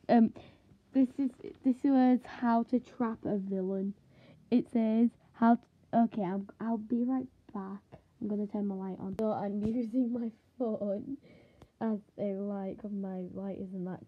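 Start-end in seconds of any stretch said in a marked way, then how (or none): none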